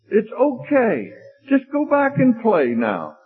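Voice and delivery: very high, drawn-out motherly cooing; pleading mother. Acted. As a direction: coax a crying child in a hammed-up high voice. Mother pleads over son's crying.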